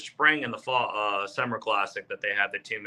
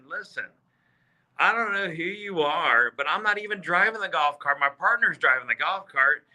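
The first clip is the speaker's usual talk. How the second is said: higher-pitched, insistent self-defence; retold firm retort; 6 semitones higher than usual. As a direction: set the heckler straight, acting out the argument. Retold argument with the heckler.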